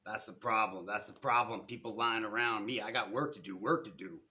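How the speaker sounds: loud, harsh scolding from a street man; gruff street character barking complaints; raspy foghorn drawl. A narrator's reading. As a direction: scold him like a cranky old foghorn. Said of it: "harshly scolding me in a raspy foghorn drawl".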